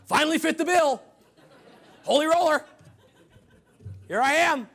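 loud, high shouts in short bursts; playful triumphant holler; mock exultant whoop. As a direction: crow it like a gleeful holy roller. Exults as holy roller in heaven.

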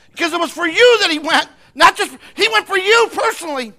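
high, full-force preacher's shout; shouted preaching. Real, not acted. Preacher urging the crowd to see Jesus, impassioned shouting. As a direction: cry it out with all your might.

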